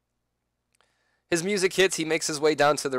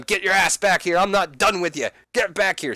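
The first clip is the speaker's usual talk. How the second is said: louder, higher angry yelling; tantrum; 7 semitones higher, 5 dB louder than usual. Acted. Seth yelling at Sting walking away, furious. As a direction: shout after him in a tantrum. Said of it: "throwing a tantrum, telling him".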